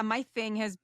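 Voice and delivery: usual speaking voice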